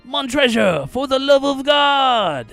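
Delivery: high, shouted, drawn-out plea; acted anguished shout; desperate cry. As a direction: cry out for mercy at full voice. Fortunato's last plea.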